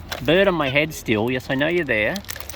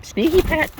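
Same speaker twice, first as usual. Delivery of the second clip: high playful name-call; playful tease; 13 semitones higher than usual. Real, not acted. Naming sneaky bird.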